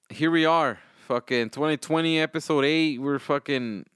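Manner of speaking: usual speaking voice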